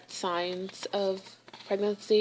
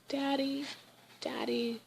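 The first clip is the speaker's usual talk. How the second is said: put-on a light, higher childlike call of Daddy; child calling Daddy; 7 semitones higher, 5 dB quieter than usual. Retelling the ad, she softly mimics kids calling out. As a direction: mimic a little kid calling out.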